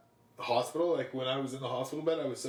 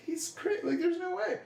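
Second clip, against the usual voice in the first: high disbelieving voice. Retold or imitated. Retold disbelief at doctor.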